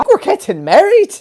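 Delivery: gasp, then a high, loud squeal; hammed-up high-pitched excitement; mock overjoyed announcement. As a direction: squeal it out like thrilling news. Acted squeal after quoted speech.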